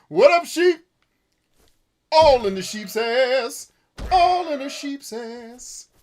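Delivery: high, loud, drawn-out taunts; over-the-top high-pitched hollering; playful battle yelling. Pretend. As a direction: holler gleefully at the game sheep. Yelling at sheep while attacking them.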